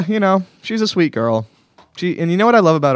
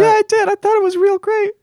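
high falsetto woman's voice; man doing a woman's voice. Pretend. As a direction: squeak it out like a girlfriend on the phone. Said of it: "Do you like my girl voice?".

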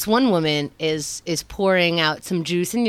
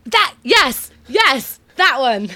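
excited; 7 semitones higher, 6 dB louder, much slower than usual. Real, not acted. Excited agreement.

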